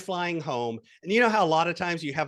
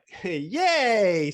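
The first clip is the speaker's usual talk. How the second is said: sarcastic cheer; 3 semitones higher, 4 dB louder than usual. Retold or imitated. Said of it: "It's like".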